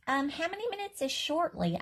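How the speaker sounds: put-on high, curious little girl; eager child question. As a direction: ask the teacher in a high little voice. Child asking teacher.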